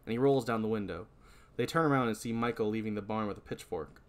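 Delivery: usual speaking voice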